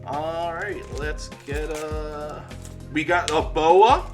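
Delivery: normal talking voice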